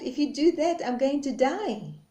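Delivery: pleading reed. Acted. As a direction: beg God not to cut you. Reed pleads against death.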